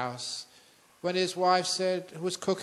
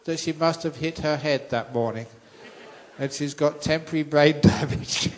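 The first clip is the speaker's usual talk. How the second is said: louder, chuckling through the punchline; monk laughing at his own joke to the audience; 7 dB louder than usual. Real, not acted. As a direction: giggle your way through the joke.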